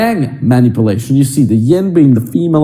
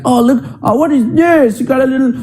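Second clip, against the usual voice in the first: put-on higher, playful girlfriend teasing about his belly; 9 semitones higher than usual. Girlfriend teasing her man's belly while hugging, playful higher coo. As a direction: coo it like she's poking his tummy.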